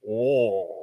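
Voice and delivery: "oh" drawn out low, like awl; mushed Southern oil. Retold or imitated. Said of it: "I hear".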